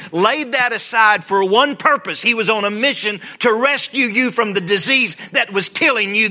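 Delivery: high, loud, impassioned preaching; preaching at full force. Acted. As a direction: build it up and preach it out. Preaching climax.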